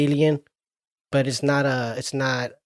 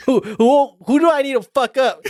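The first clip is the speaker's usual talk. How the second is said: tough alien swagger; 7 semitones higher than usual. Retold or imitated. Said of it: "making sure".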